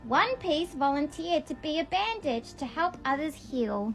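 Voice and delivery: normal talking voice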